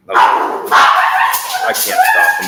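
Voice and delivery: yelling high and loud to split up fighting dogs; real angry yelling. Real, not acted. Owner yelling at his fighting dogs, furious shout. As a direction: bellow at the dogs to stop now.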